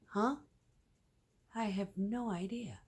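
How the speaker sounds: high huh then whispered shrug; mock bafflement. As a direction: play the confused meme. Voicing Pink meme.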